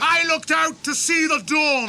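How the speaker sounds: loud, high defiant shout. Acted. Aragorn shouts back defiantly at the orcs. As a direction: fling it back at them.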